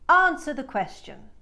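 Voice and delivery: loud transatlantic shout with a British A; shouted Bette Davis line. Retold or imitated. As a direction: bark it like an old film star. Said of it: "Bette Davis shouts this line".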